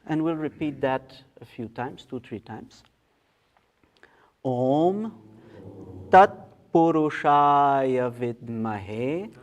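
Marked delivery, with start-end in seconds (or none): 4.4-9.3 slow intoned Sanskrit chant, vowels held long; leading mantra recitation. Shown as a demonstration. Teacher leading the group through the Ganesha Gayatri, slow mantra recitation. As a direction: intone each phrase slowly, leaving room to join in. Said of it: "let's try to do that together".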